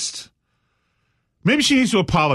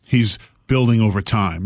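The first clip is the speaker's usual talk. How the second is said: low, grave detective musing; a lightly voiced gruff detective; 5 semitones lower than usual. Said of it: "the detective is like".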